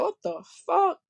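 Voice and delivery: high-pitched retold exasperation; retold pissed-off disbelief. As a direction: let the pitch jump up in baffled annoyance. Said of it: "I was pissed".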